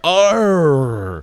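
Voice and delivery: loud pirate "Arr"; pirate growl. Retold or imitated. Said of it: "the karaoke guy's like".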